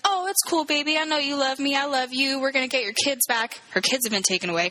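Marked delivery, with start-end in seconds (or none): 0.1-3.6 higher-pitched mock reassurance; imitated soothing boyfriend. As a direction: lightly play a forgiving boyfriend. Retold boyfriend soothes his girlfriend.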